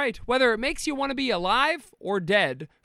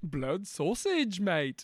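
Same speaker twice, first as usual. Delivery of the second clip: slow, softer fake British drawl; mock British accent; 7 dB quieter, much slower than usual. Topping the co-host's imitation, slow soft mock-British drawl. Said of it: "that's exactly how they sound over there".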